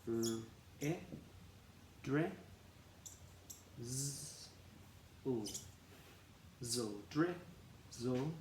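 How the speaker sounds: d, r, i, dri, z, l, then blended slowly into drizzle; sounding out drizzle. Shown as a demonstration. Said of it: "and we say d".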